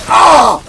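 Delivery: loud sudden cry of pain; sharp startled ouch; pained yell. Real, not acted. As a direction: shout as the trap hits. Hit by trap.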